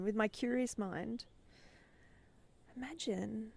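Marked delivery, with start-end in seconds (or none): 2.8-3.5 breathy unvoiced whisper of imagine. Shown as a demonstration.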